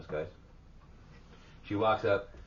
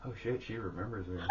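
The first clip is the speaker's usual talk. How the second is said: laughing softly; 9 dB quieter than usual. Real, not acted. Laughing realization.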